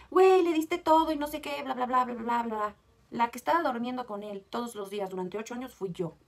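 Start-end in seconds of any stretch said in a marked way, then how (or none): 0.1-2.7 louder mock of fans' lecture; mocking followers. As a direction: parrot the fans dismissively. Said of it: "tus seguidoras van a decir".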